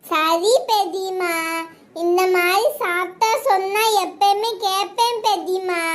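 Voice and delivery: put-on slow singsong baby talk; little child's voice. Small child promising aunt to obey, singsong baby talk. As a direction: promise it slowly in lisping toddler speech.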